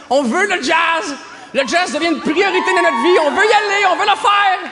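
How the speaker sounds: loud, high, building zeal; mock fanatic frenzy. Festival fan desperate to go, mock frenzied shout.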